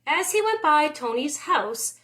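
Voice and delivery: plain reading voice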